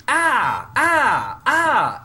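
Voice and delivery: three high rising "ah" yelps; mock vanishing cries. Host imitates the ghost vanishing, high yelps. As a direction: yelp "ah" three times, high, as if zapped away. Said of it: "because he goes".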